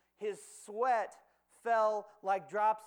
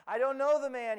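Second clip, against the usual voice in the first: louder, higher, pushing the denial hard; insistent denial; 4 semitones higher, 7 dB louder than usual. Acted. Peter denies Jesus to the crowd, loud and insistent. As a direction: Peter insists he doesn't know Jesus, raising his voice.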